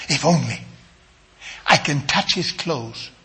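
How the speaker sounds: low, soft, reflective repeat; hushed quote. Retold or imitated. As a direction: say it softly, as if to herself. Hushed repeat of the woman's words.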